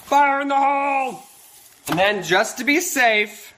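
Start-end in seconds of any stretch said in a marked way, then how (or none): 0.1-1.2 hearty callout before the drop; calling out. Real, not acted. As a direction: call it out to the room. Calling out before dumping sprinkles.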